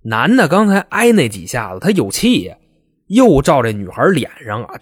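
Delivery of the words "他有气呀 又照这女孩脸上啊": usual speaking voice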